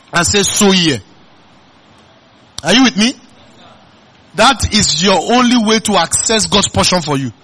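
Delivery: loud, high, driving sermon delivery; full-force preaching. Acted. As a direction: preach it out to the whole church.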